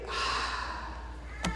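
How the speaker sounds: soft, breath-only sigh of giving up; resigned sigh. Retold or imitated. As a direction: let out a defeated sigh. People giving up, voiced.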